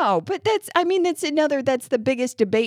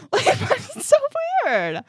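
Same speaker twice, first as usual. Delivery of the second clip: laughing; slower than usual. Real, not acted. Laughing in disbelief.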